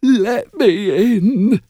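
high, very slow wailing plea; crying ghost child. A narrator's reading. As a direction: beg through tears to come in. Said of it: "the child cried again".

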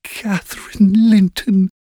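high, slow ghost voice, trembling with cold; a frozen child ghost naming herself; shivering ghost voice. A narrator's reading. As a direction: ghost child shivering as she gives her name. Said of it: "the voice replied, shivering with cold".

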